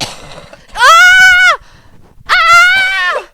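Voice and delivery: high shrieking melodrama wail; dramatic wailing shout. Acted. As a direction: wail it like a tragic heroine. Sudden dramatic outburst at cough.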